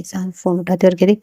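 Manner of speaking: ordinary delivery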